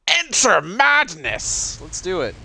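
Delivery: slow, high, drawn-out proclamation; grand mock-ominous call; dramatic announcer. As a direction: announce it like a horror trailer. Dramatic game intro line.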